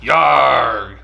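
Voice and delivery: pirate growl. Acted. Pirate exclamation.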